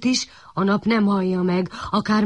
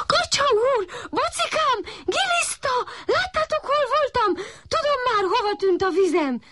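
very high puppy cry to his friends; proud puppy calling to duck, calf and worm; calling out excitedly. A narrator's reading. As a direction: shout the news with joy. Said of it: "kiáltott a kiskutya".

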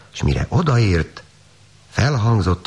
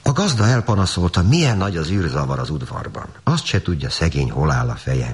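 ordinary delivery, nothing marked

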